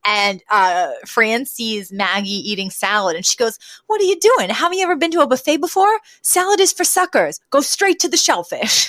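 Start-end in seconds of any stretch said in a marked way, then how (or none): 3.8-8.9 high-pitched, incredulous sitcom quote; mock-incredulous scolding. Fran catching Maggie eating salad, incredulous scolding. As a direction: scold them in a high, scandalized voice.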